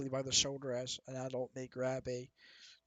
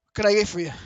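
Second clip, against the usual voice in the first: louder, higher friendly barkeep voice; barkeep greeting; 6 semitones higher, 14 dB louder, faster than usual. Acted. Barkeep greets a customer, louder and higher. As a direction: call it out across the bar.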